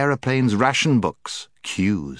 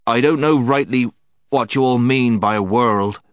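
loud, higher, labored speech; short of air; 5 semitones higher, 6 dB louder than usual. A narrator's reading. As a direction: force out each phrase against the spell. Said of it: "talking like a man who hasn't enough air".

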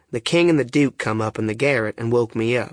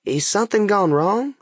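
timid question, pitched well up; 9 semitones higher than usual. A narrator's reading. Boy asking the two frauds what happened, timid. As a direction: ask it meekly on a high rising voice. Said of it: "kinda timid-like".

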